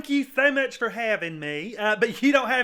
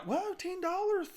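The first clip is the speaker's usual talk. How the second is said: surprised; 8 semitones higher, 6 dB quieter than usual. Retold or imitated. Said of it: "And I was like".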